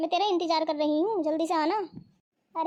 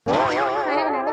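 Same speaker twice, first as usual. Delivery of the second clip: slow, drawn-out mocking echo of his demand, louder; 5 semitones lower, 5 dB louder, much slower than usual. Wife mimics her husband's food demand, slow and mocking. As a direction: stretch each word to ridicule him.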